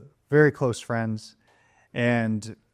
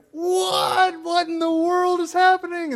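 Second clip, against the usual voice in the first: very high, louder shriek of disbelief; stunned shriek; 3 dB louder than usual. Retold or imitated. Daughters seeing their friend pop out, shrieking in surprise. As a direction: squeal in total shock. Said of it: "my girls are like".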